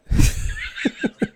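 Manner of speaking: high breathy evil cackle; villain laugh. Real, not acted. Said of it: "I've got the perfect villain laugh".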